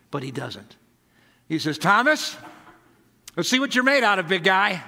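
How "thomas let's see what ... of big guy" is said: challenging call. Acted. Jesus challenging Thomas.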